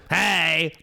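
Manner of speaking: hammed-up Beetlejuice-style yell; scare actor bark. Shown as a demonstration. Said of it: "One of them's".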